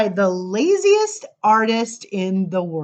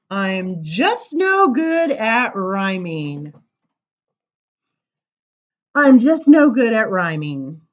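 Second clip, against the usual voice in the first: lively sing-song verse reading; poem reading; 5 semitones higher, 8 dB louder than usual. Acted. Reading a funny poem to the crowd, sing-song. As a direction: bounce through the rhymes for listeners. Reads rhyming poem aloud.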